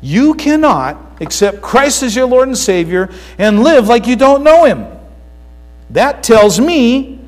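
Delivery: loud, high, emphatic sermon voice; forceful preaching. Real, not acted. Pastor warning the congregation about false faith, forceful preaching. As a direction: drive the warning home at full volume.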